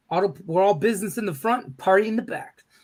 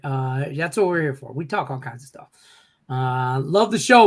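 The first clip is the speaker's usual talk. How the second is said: normal talking voice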